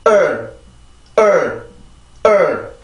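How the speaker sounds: "을" as an r-coloured er with tense vocal cords; tensed "을" vowel. Shown as a demonstration. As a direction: drill "을" as a stressed er, separately each time. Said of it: "성대만 긴장을 시켜주면 되죠".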